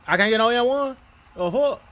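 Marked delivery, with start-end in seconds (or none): none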